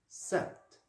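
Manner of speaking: sept as "sèpt", both final consonants heard; sept, sounded P. Shown as a demonstration. Teacher repeating the second way for pupils, sept with P. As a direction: let the P sound before the T in sept. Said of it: "en prononçant la lettre P".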